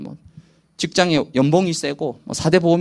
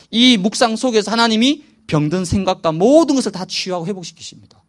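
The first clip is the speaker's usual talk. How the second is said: ordinary talk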